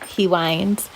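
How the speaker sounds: usual speaking voice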